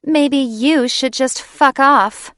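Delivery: bare your teeth and spit it; feral snarl. Acted. Catherine snarling at Sarah, slow and loud. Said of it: "feral snarl".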